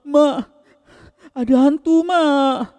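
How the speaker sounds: put-on very high, loud wails of a frightened boy; wailing. A narrator's reading. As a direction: wail for mum in short, panicked bursts. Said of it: "Hussein ni masih meraung. Meraung-raung". (meraung ketakutan memanggil emak)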